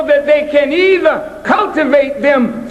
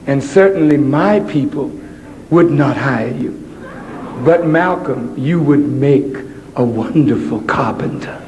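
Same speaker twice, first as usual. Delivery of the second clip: low, patronizing teacher voice; condescending teacher; 12 semitones lower, 3 dB quieter than usual. Retold or imitated. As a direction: talk down sweetly to the child.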